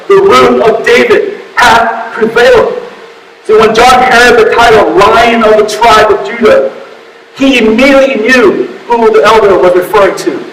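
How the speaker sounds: high-pitched, urgent sermon delivery; impassioned preaching. Real, not acted. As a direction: preach it forcefully, warning of doom. Preaches at full force.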